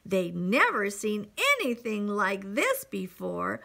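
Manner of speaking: high, excited narration; story read to children. A narrator's reading. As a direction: build the wonder with growing energy. Reads picture book to children.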